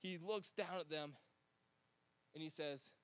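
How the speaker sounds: ordinary talk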